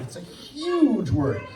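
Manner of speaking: slow "It's a huge word", "huge" drawn out and leaned on; stress on huge. Shown as a demonstration. Telling the group that sexuality is a big word, "huge" stressed slowly. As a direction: stretch "huge" to show its size. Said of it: "we need to stress".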